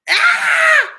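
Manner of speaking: sharp high yelled "Ah!" of mock rage; hammed-up outburst when the online class freezes; frustrated shout. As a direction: blurt out an exasperated cry. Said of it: "empiezan con una reacción terrible".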